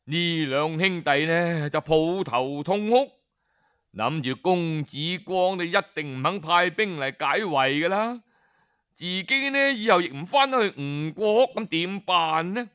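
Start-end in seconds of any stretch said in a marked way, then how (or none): none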